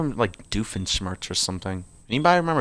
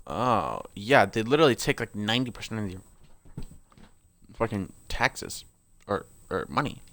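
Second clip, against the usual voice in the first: usual speaking voice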